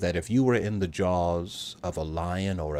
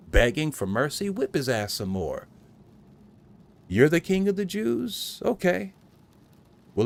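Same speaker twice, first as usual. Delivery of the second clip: mocking tormentor voice; mocking soldier taunt; 5 semitones higher than usual. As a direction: sneer it out like a cruel guard.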